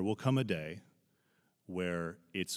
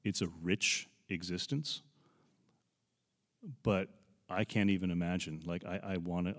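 ordinary delivery

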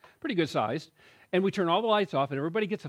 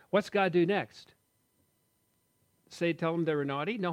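normal talking voice

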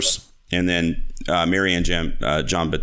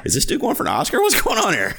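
rising, much higher incredulous exclaim; amazed disbelief; faster than usual. Retold or imitated. Viewer stunned by Mel's speech, high incredulous. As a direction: gasp it out like you can't believe the performance.

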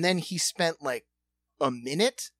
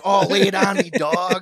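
excited; 3 semitones higher, 8 dB louder than usual. Real, not acted. Eagerly urging friend to perform.

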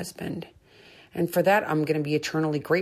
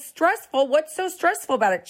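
mock disbelief; 11 semitones higher than usual. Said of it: "I was like".

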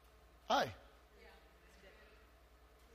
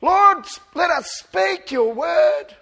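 loud, high, slow pleading prayer; early church praying for boldness, loud and slow; fervent prayer; 8 semitones higher, 7 dB louder, slower than usual. Retold or imitated. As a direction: pray it out with full fervour.

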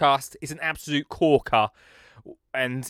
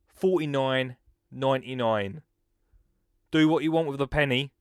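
ordinary delivery

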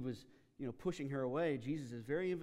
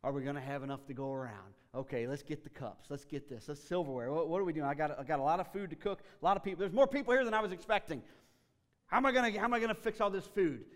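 frantic worry; 2 semitones higher, 8 dB louder than usual. Retold or imitated. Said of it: "Martha is so worried about".

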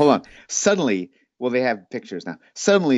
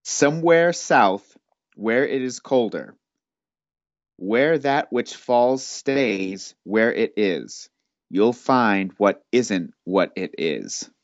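slow, measured riddle read with long pauses; slow riddle recital; much slower than usual. Acted. Game master reading the riddle aloud, slow and measured. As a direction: draw out each line like a solemn riddle.

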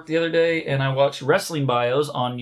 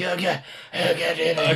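mock groan; 4 semitones higher than usual.

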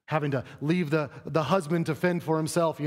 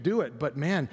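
normal talking voice

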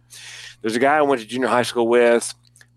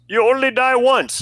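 much higher, odd put-on voice; mock the interviewee's blithe answer; mock terrorist; 12 semitones higher, 3 dB louder than usual. Quoting a terrorist on television, high mocking voice. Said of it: "And he said this".

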